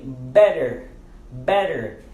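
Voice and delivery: "better" with a quick D sound in place of the double T; American flapped T. Shown as a demonstration. English teacher modelling "better" for learners, T said as D. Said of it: "Nós pronunciamos o D ali".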